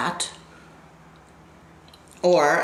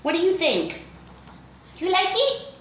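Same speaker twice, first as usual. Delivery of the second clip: excited high questions; 14 semitones higher than usual. Real, not acted. Vlogger asks viewers about her hair, eagerly. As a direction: pitch jumps way up, bright and eager.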